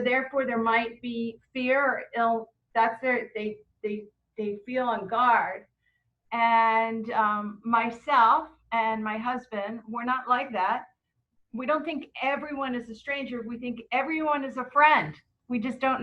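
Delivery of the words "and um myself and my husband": usual speaking voice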